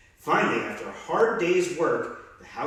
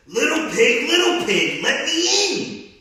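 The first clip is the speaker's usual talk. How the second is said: high, loud wolf call, slowing at the end; a big bad wolf calling loudly at the door; wolf growl; 13 semitones higher, 8 dB louder, slower than usual. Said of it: "he growled".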